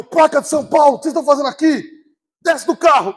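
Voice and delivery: officer grilling out-of-state drivers; stern cop. Retold or imitated. Armed officer questioning outsiders.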